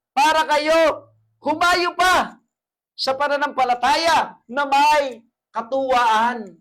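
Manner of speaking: loud, high, slowed sermon cry; full-force preaching. Acted. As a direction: preach it out to the congregation.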